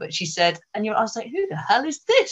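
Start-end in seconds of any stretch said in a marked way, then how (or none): 1.3-2.3 high-pitched mock disbelief; retold startled who's-this, voice jumping up; incredulous question. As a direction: react as if to a ridiculous stranger. Acting out her startled reaction.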